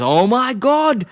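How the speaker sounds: a hammed-up chef realising his mistake; mock horror. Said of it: "looks and goes".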